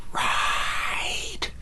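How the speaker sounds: hushed, breathy skeleton command; ghostly command. Acted. Skeleton whispering a spell over the sofa. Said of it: "skeleton said".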